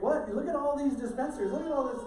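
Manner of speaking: put-on high, loud, incredulous kid voice; kid's disbelief. Kids at pastor's office protesting the empty M and M dispensers, high and loud. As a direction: play a baffled kid pointing at empty candy dispensers. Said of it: "And they're like".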